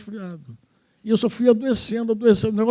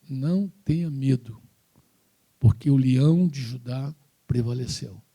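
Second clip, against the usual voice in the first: lower, slow, reassuring; calm voice of God; 6 semitones lower than usual. Retold or imitated. God speaks to him.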